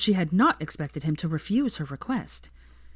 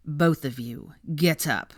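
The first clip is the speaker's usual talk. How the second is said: plain reading voice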